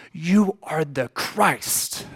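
very slow, weighty confession; slow declaration. Acted. Peter answering Jesus, slow and reverent. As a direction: savour each word.

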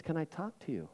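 grieving man asking the pastor softly; quiet plea. Retold or imitated. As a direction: ask it barely above a murmur. Sobbing man asks quietly.

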